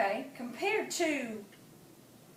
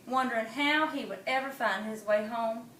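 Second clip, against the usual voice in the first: plain reading voice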